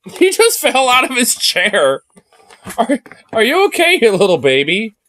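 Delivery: squealing with laughing concern; relieved laughter. Real, not acted. Dog falls off chair, she laughs.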